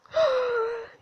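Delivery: high gasp of delight; sharp, high, smitten gasp. Acted. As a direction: gasp at something adorable. Reaction to the cute robot reveal.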